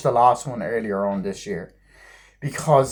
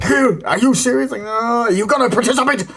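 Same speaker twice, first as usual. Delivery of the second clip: higher pitched mock scolding; mock scolding teacher; 10 semitones higher, 4 dB louder than usual. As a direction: snap at the kid in mock outrage. Voicing the angry teacher.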